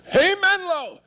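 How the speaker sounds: a bright call across the whole office; loud call. Shown as a demonstration. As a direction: shout it high to the room. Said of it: "you gotta be loud".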